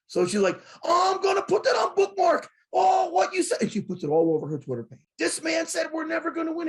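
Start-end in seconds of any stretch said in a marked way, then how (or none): 0.8-3.6 high, loud imitation of an indignant woman; mock mad. Show host mad at a comment about her team, loud mock outrage. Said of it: "she's mad". 5.2-6.7 high-pitched imitation of indignation; mock mad. Mad show host posting his comment to her followers, mock indignation. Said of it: "she's mad".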